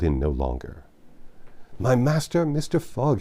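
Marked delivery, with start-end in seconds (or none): 1.8-3.2 raised, pleading cry to his master. A narrator's reading. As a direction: servant crying out in remorse. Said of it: "he cried".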